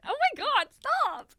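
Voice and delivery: high, loud, embarrassed protest; embarrassed squeal at the host's praise; flustered squeal. Real, not acted. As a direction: squeal at the friend to stop. Embarrassed by compliments.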